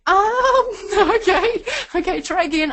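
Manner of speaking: amused disbelief; laughing. Retold or imitated. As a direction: laugh through it, then try again. Laughing at the wrong cable.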